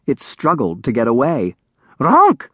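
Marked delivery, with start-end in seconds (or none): 2.0-2.5 high, loud creature honk; dragonling squawk. A narrator's reading. Dragonling struggling to get away, loud squawk. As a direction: squawk it sharply, pitched way up. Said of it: "it squawked".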